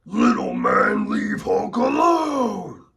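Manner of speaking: high, slow bellowing Hulk shout. Retold or imitated. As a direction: yell it like an angry giant. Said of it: "what's another one that Hulk always says".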